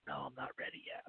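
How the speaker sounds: breathless whispered refusal; panicked whisper. Retold or imitated. Pleading with himself while rocking in the hammock, whispered.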